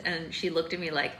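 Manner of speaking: usual speaking voice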